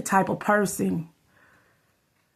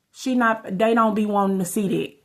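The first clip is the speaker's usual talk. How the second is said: ordinary delivery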